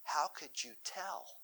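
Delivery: hushed, slow mock astonishment; softly acted shock, stretching the words; imitating shocked reaction. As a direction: play a stunned teen, softly and drawn out. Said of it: "they look, they look shocked".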